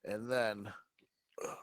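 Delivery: usual speaking voice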